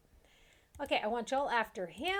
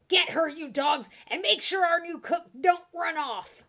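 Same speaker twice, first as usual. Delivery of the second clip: gruff bandit leader; 8 semitones higher, 11 dB louder than usual. Acted. Bandit leader ordering his men.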